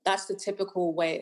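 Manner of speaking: usual speaking voice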